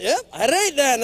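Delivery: lightly Scottish "all right then"; a hint of a Scottish accent; mock Scottish accent. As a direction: act the Scotsman in a kilt. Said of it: "I'm acting like the Scotsman".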